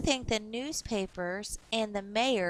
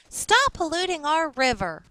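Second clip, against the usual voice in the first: louder and a bit higher, firm; determined girl; 6 semitones higher, 6 dB louder than usual. Acted. Shawnee to her class, a firm, louder call to action. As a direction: say it like a protest sign. Shawnee proposes letter slogan.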